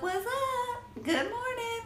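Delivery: high, sing-song good morning; sing-song greeting. Real, not acted. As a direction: chirp it up high.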